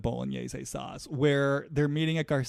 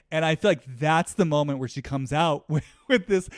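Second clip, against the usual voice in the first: normal talking voice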